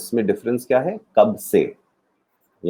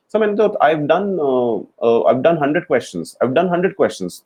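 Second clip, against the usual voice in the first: higher, slow, fumbling boast of a student; hesitant student; 6 semitones higher, much slower than usual. Retold or imitated. Student bluffing to his teacher, slow and fumbling. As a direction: stumble over the uh's like a caught-out pupil.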